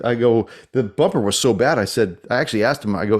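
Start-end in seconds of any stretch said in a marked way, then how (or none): none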